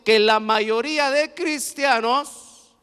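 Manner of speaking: loud, slow stress on la mayoría de cristianos; slow emphatic warning. Real, not acted. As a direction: weigh every word of la mayoría de cristianos. (énfasis lento en «la mayoría de cristianos»)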